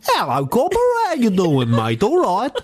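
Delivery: high, hammed-up Australian accent. Shown as a demonstration. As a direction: put on a broad mock Australian greeting.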